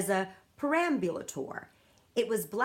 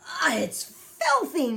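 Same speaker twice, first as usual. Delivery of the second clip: higher, louder, slow sputter; 9 semitones higher, 7 dB louder, slower than usual. A narrator's reading. Giant eating snozcumber in front of Sophie, sputtering. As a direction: spit the words with a mouthful. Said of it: "he sputtered".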